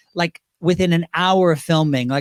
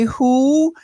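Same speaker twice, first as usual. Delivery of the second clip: lightly put-on diva, pitched higher; a higher, cantankerous diva's question; 6 semitones higher, 3 dB louder than usual. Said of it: "that was my version of [name]".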